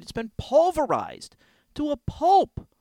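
high, whiny wail; hammed-up devastation; despairing lament. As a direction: climb in pitch as the despair builds. Laments ruined Christmas spirit.

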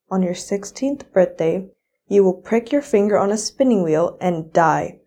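ordinary delivery

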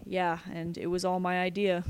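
ordinary delivery

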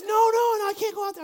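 high, loud mock-panicked protest; panicked child. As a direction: protest like a frightened little kid. Acting out panicked daughter refusing.

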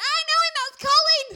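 high, loud mock yell of recognition; acting out a friend's excited yell; excited shout. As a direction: shriek it as if spotting someone you know.

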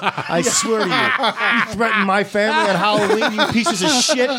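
high, hammed-up threatening yell at the window; barbarian crazy dad. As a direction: play an unhinged dad guarding the house. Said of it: "like Crazy Dad with a golf club going".

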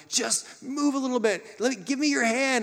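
put-on God calling to his stuck child, pleading; God coaxing. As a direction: reach out your hand, urging. Voicing God at the slide.

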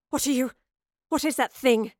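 loud, demanding questions. A narrator's reading. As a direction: confront the girl sharply. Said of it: "she demanded to know".

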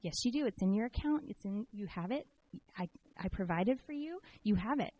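soft, patient voice of God; gently insist, as God answering a doubter; quiet and sure, lightly acted; calm divine reassurance. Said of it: "And he was like".

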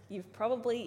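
normal talking voice

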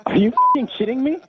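high, loud disbelieving outburst; furious shout. Real, not acted. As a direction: explode at the caller. Trainer furious at caller.

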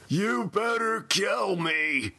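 slow, high, desperate yell; grim inhuman yell. Acted. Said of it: "Todd yelled in tones so grim they sounded inhuman".

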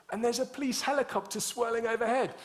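high-pitched, agitated report; frantic landlady. Retold or imitated. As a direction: blurt it out in a frantic rush. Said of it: "And then she said".

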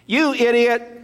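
put-on child's shrill yell at a driver; yelled insult. Four-year-old yelling at a driver from the car, loud mock shout. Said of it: "she yelled".

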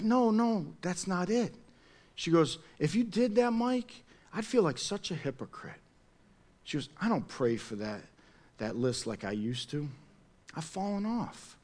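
soft, hushed imitation of a friend in tears; retelling a crying church friend's guilt, softly; retold tearful friend. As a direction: mildly voice her tearful confession. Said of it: "she starts bawling".

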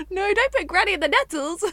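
loud, high laughing outburst; amused exclamation. Real, not acted. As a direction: blurt it out, cracking up. Amused mock warning.

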